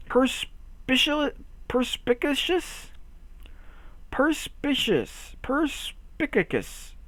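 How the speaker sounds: slow, careful sounding out of the word. Shown as a demonstration. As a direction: drag out each syllable. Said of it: "What even is that word?".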